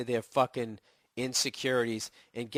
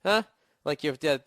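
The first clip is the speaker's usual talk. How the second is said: ordinary talk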